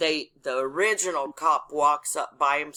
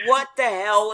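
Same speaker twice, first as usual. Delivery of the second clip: raised, louder disbelief; incredulous; 5 semitones higher, 6 dB louder than usual. Retold or imitated. Neighbor watching the traffic stop, loudly incredulous. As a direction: act out a baffled onlooker, voice up. Retold bystander's baffled reaction.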